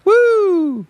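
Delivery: imitated crowd "woo", very high; shrill imitated party whoop; high whooping scream. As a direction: whoop like an audience member. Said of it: "she screamed and she goes".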